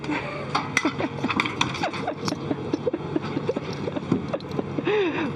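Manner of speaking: long delighted laughter; gleeful laugh. Real, not acted. Laughing after flying up.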